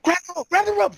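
high, loud panicked party cry; urgent mock shout. Party spots the rope running out, urgent high shout. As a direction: call out in alarm, acted.

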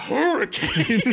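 high, hammed-up astonished echo; mock freak-out. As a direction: gasp it out like an overexcited ghost hunter. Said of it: "and he goes".

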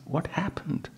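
soft asking. Acted. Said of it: "Ajay said".

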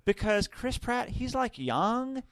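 put-on swooning teenager; valley girl voice. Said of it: "why she wanted to see it".

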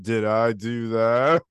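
slow, low attempt at Urkel; Urkel impression. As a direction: drag out the catchphrase in a put-on voice. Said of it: "so with the voice you have".

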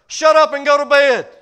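loud, high exasperated mother snapping; fed-up mother. Retold or imitated. Worn-out mama yells up at her son, loud and fed up. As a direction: bark it like a tired mother at her wits' end. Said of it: "mama loses patience".

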